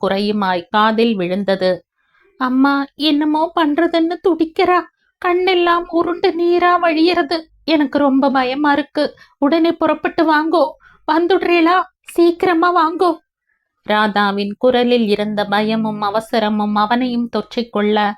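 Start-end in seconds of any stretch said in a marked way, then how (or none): 2.4-13.2 high, panicked wife sobbing on the phone; frightened crying. Acted. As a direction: beg him to come home fast, terrified. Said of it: "பயத்துடன் அழுதபடியே அவள் பேசியது". (பயத்துடன் அழுதபடி ஃபோனில் பேசும் மனைவி)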